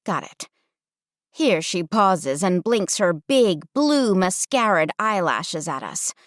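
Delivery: usual speaking voice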